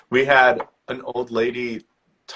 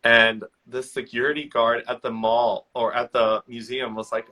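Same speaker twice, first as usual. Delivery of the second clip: ordinary delivery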